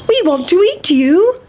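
put-on high, eager children's voice; hungry little boys. Boys to fleeing pancake, hungrily calling. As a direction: call it out high, swooping up at the end.

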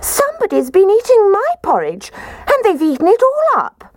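very high, upset cub voice; squeaky baby bear. A narrator's reading. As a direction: squeal it like an outraged little bear. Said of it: "Baby Bear positively squeaked when he said".

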